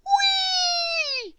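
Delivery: very high gleeful cry; joyful whee. A narrator's reading. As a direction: squeal with delight. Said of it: "he cried".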